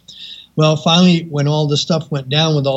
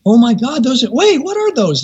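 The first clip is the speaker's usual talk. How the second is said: very high-pitched retold alarm; shocked; 9 semitones higher than usual. Flight attendant spotting gliders on plane, shocked. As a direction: imitate a startled double take, voice shooting up.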